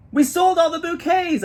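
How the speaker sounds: very high, loud amazed shout; girl shouting to her friend at the wagon; shouting back. A narrator's reading. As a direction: yell the surprise back. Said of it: "I shout back".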